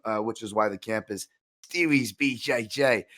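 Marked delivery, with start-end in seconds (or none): 1.7-3.1 put-on slow, hammy Australian accent; bad Australian accent. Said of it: "my terrible Australian accent there".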